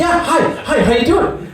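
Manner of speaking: put-on high-pitched chirpy shop-girl voice; lightly voiced perky saleswoman; bubbly greeting. Cheerful shop woman greets him.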